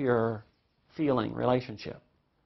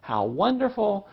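put-on high-pitched, gushing interest in a child; imitating an enthusiastic, encouraging father; doting daddy; 9 semitones higher, 4 dB louder than usual. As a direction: act out a delighted dad asking about school. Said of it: "and he would say".

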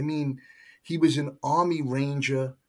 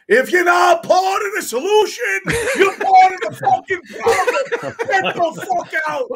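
yelling much higher and louder, laughing through the end; screaming at the rookie to get out; angry bellow; furious shout; 11 dB louder than usual. Retold or imitated. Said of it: "all I remember was going".

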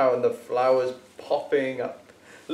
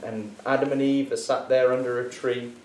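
usual speaking voice

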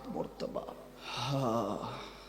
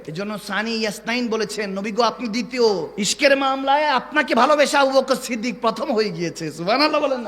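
louder, higher sermon swell; fervent, raised preacher's voice; rousing preaching; 7 semitones higher, 9 dB louder than usual. Acted. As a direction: build up to rouse the congregation.

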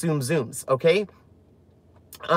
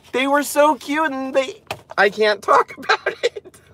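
choked up; 8 semitones higher than usual. Real, not acted. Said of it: "I'm gonna start crying".

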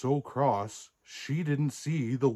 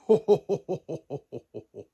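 long ho-ho-ho falling in pitch and fading; Santa belly laugh; 5 semitones higher, 3 dB louder than usual. Acted. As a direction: chuckle like Santa, trailing down. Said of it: "Stories with Santa".

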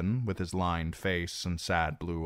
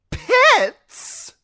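shrill high scream, much louder; screech; 14 dB louder than usual. Acted. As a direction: shriek the word in outrage. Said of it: "screeched Reznak".

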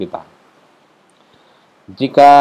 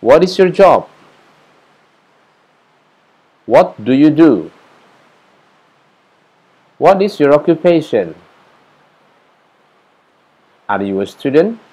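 model questions; 6 semitones higher, 6 dB louder than usual. Shown as a demonstration. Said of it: "ungkapan seperti ini".

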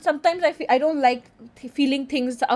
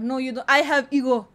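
ordinary talk

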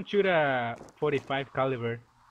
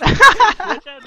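loud sudden yell; shouted warning; 16 dB louder than usual. Real, not acted. Warning teammate of van.

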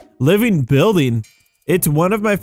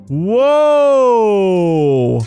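long drawn-out, higher whoa; amazed whoa; 4 semitones higher, 4 dB louder than usual. Real, not acted. Gamer amazed by the giant creatures, drawn-out amazement. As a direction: let out a long, awed whoa.

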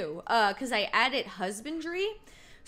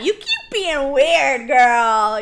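loud cheering; 11 dB louder, slower than usual. Real, not acted. Cheering Robin on loudly.

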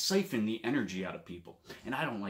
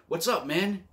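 upbeat greeting; 7 semitones higher than usual. Retold or imitated. Greeting John at work.

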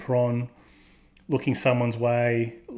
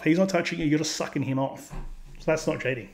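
normal talking voice

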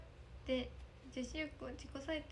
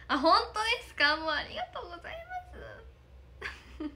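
tearful thanks; 9 semitones higher, 7 dB louder than usual. Real, not acted. Moved by a fan's compliment.

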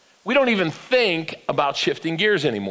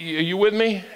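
ordinary talk, nothing marked

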